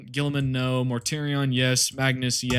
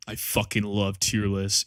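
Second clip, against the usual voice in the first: ordinary talk, nothing marked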